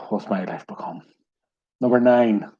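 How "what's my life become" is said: sigh, then low-key, soft resignation; weary sigh. Real, not acted. Quizmaster despairing after reading out pop lyrics, weary and quiet. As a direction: let it drop soft with a sigh.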